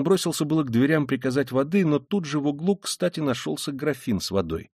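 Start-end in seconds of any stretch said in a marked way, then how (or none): none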